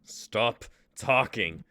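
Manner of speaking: a slow, hard, loud command; angry outburst. Acted. Nick snapping at the voices in his head, loud and slow.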